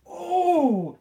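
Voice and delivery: high, loud sympathetic oh; pained wince. Real, not acted. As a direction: wince as if you felt it. Wince at groin landing.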